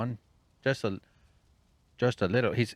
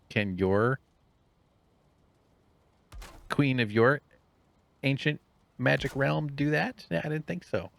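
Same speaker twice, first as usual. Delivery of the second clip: 1 taunting; 6 semitones higher, 7 dB louder than usual. Pretend. Taunting rival queen.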